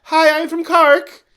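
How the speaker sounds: high, loud singsong Cork accent; exaggerated Cork lilt, high and drawn out. Shown as a demonstration. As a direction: go up and down, almost singing, like Cork. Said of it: "they kinda almost sing a little bit".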